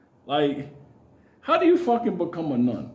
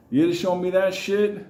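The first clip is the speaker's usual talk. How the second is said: ordinary delivery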